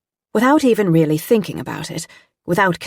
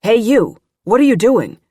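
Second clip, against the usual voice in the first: loud, higher gruff demand; gruff challenge; 5 semitones higher, 7 dB louder than usual. A narrator's reading. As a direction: challenge an intruder gruffly and loudly. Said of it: "the agent said gruffly".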